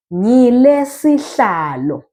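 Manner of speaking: "Ngile sihlalo" slow and drawn out; slow teacher's demo of "Ngile sihlalo"; model pronunciation. Shown as a demonstration. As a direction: stretch "Ngile sihlalo" for learners. Teacher models phrase for learners.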